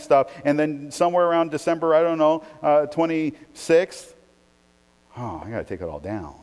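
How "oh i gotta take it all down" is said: mock weary grumble.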